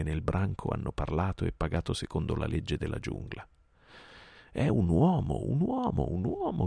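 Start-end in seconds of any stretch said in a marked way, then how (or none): 4.5-6.7 high-pitched swooping wolf cries; snarling pack. A narrator's reading. Wolf pack rejects Mowgli as a man, snarling cries. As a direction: chant it high like baying wolves. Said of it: "gringhiò il branco".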